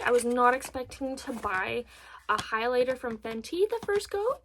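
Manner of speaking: normal talking voice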